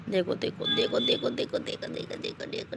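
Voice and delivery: starts soft and drops to a breathy whisper; fading to a whisper. Real, not acted. Mother watching her baby daughter climb down, fading to a whisper. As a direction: let each देखो fade until it is only breath.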